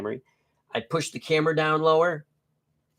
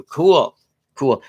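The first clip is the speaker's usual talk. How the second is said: excited; 7 dB louder than usual. Real, not acted. Reacting to chat.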